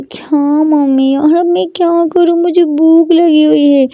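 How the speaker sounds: put-on high, slow sulky girl's whine; pouting whine. A sulky daughter complains to her mother she is hungry, whining. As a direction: pout and drag out the complaint. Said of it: "मुँह बना के बोलती है".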